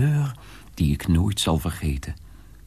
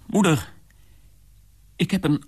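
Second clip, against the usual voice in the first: second call to mother, much higher; urgent call; 11 semitones higher, faster than usual. Acted. Son urgently calling his mother a second time. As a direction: cry out to her, rising in urgency.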